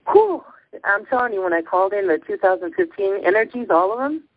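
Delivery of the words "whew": high laugh and whew. Real, not acted. Ecstatic release.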